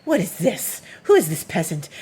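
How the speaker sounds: mock-indignant, punchy disdain; haughty outrage on behalf of a cat; outraged cat. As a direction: act out an affronted royal pet. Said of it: "like, outraged. She's outraged".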